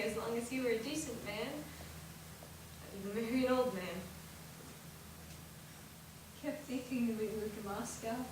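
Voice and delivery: soft, low, gentle sisterly voice; tender advice. Acted. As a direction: counsel her softly as a loving sister. Older sister gently advises.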